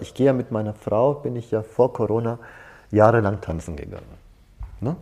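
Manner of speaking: normal talking voice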